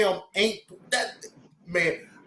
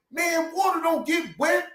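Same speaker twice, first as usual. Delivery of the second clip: mock angry arguer; 10 semitones higher than usual. Imitating the arguing man.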